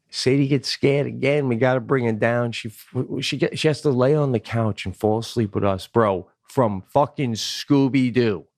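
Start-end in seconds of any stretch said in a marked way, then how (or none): none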